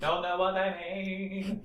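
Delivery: high, soft squeaky repeat; squeaky echo. Pretend. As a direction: squeak it back, slowly. Echoing cohost in squeaky voice.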